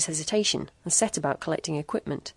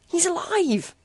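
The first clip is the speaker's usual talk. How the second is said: high frightened scream, louder; terrified scream; 13 semitones higher, 3 dB louder, slower than usual. A narrator's reading. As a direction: scream it in terror. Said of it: "screamed June".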